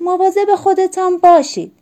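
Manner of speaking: high, loud farewell call. A narrator's reading. Mother to departing father and son, calling out. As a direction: call after them as they go.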